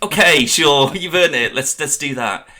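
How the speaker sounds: loud, high, laughing permission; laughing indulgence. Retold or imitated. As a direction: give in with a laugh. Indulging her team member.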